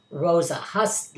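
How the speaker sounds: usual speaking voice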